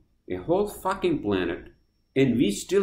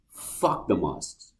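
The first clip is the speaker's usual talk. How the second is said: low, slow and softer; slow dismissal; 8 semitones lower, 5 dB quieter, much slower than usual. Real, not acted. Vlogger rejecting mask rules, slow low dismissal. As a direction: say it slowly, dropping the voice. First take of repeated verdict on masks.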